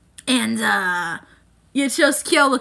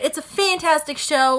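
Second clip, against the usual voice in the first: ordinary talk, nothing marked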